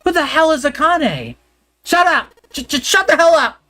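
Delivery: high, shouted and shaken; startled yell. Acted. Junpei startled by a voice, yelling in shock. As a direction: shout it down, rattled and angry.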